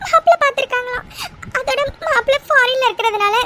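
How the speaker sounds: high-pitched frightened crying; tearful fear. Acted. Girlfriend dreads the engagement her parents want, scared and crying. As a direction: sob the news out, pitched high. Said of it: "இப்படி பயப்படுற".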